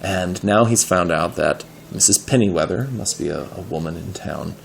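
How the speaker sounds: ordinary delivery, nothing marked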